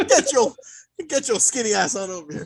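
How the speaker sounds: high, loud summons in a put-on voice; mock yell. Host ordering Jenny over from the back, playful yell. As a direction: holler someone over from the back. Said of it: "I was yelling at my girl".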